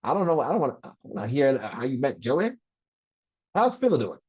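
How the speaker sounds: mock Alabama dad voice, rising at the end; father's Alabama accent. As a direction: imitate your Alabama father, swooping up and down. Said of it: "With an Alabama accent and everything".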